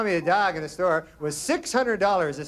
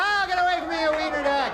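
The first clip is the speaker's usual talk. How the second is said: high, startled cry at a biting dog; panicked yell; 10 semitones higher than usual. Retold or imitated. Startled sleeper yelling at the wiener dog nibbling his vein, high panicked cry. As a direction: shriek it awake, shove the dog off.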